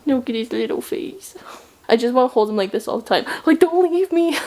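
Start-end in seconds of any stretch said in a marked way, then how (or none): 0.0-1.3 overwhelmed by cuteness. Real, not acted. Cooing over the dog. 3.5-4.4 overwhelmed by cuteness. Real, not acted. Cooing to the dog.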